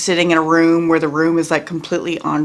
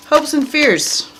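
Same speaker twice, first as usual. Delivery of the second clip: ordinary delivery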